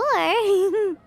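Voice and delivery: a short cheeky giggle; playful little laugh after asking for mora. Acted. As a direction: giggle at your own greedy joke. Paimon laughs at her own hint.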